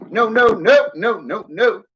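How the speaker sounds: high, frantic repeated no; panicked. Real, not acted. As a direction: protest in rising panic. Panicking in game.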